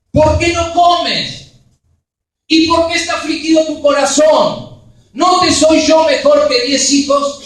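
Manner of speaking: a husband begging his wife to explain her tears; pleading husband. Retold or imitated.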